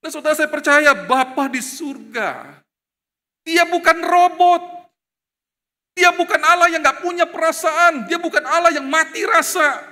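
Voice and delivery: high, loud, insistent sermon delivery; full-force preaching. Real, not acted. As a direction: drive each point home to the congregation.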